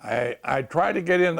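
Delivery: usual speaking voice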